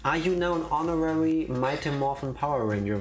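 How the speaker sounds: ordinary talk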